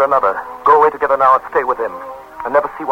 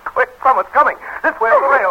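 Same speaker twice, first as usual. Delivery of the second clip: urgent hurry; 14 semitones higher than usual. Acted. Fleeing as someone approaches.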